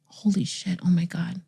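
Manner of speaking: soft, aghast aside; stunned whisper. Acted. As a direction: breath-only murmur of dawning horror. Dawning realization of daughter's age.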